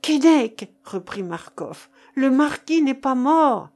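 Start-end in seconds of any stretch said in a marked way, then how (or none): 0.0-0.7 high, forceful shout of a name; crying out. A narrator's reading. Marcof calls his frightened companion over, crying out. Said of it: "s'écria-t-il". 2.1-3.7 loud, high, insistent exclamation; crying out. A narrator's reading. Marcof repeats his discovery to Keinec, crying out. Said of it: "s'écria-t-il".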